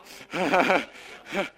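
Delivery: loud burst of real laughter; big amused laugh; hearty laugh. Real, not acted. Laughing at Joe being upset.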